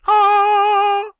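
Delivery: long, high, loud "aah"; one sustained high-pitched shout; sudden yell. Real, not acted. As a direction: let out a piercing held yell. Sudden cry during game.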